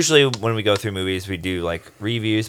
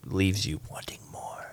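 hushed; 13 dB quieter, slower than usual. Acted. Teasing hushed tag line.

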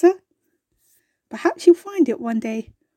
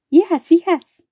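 eager yes; 6 semitones higher, 7 dB louder than usual. A narrator's reading. Birds eagerly answering.

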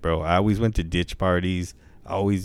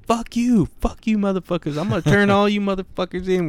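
pitched way up, imitating the teacher's yelling rant; high mock teacher yell; 12 semitones higher than usual. A welding teacher yelling back at his cursing students, high mock yell. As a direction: ham up a raging shop teacher.

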